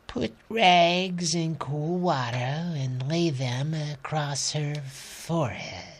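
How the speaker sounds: soft, slow, weary instructions; tired doctor voice. Acted. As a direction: give care instructions softly.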